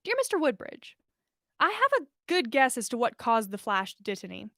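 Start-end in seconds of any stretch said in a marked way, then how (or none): none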